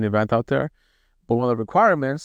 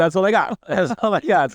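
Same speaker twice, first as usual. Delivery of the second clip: laughing it out, higher; 7 semitones higher than usual. Real, not acted. Laughing as he admits to his friend he knows only two Hindi phrases. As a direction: giggle through the words.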